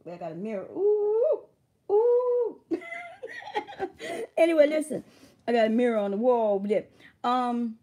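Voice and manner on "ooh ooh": high, loud drawn-out "ooh"; excited high-pitched hoot; playful whoop. Real, not acted.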